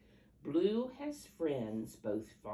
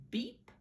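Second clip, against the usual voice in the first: truck beep; 13 semitones higher, 3 dB louder than usual. Acted. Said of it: "says Blue".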